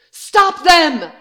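high, loud yell; frantic shout. Acted. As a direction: cry out to the others. Scientist calling out for help.

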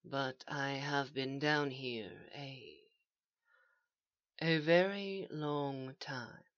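hushed, very slow, halting; slowly. A narrator's reading. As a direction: let the words out slowly and softly. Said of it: "said Thrawn slowly".

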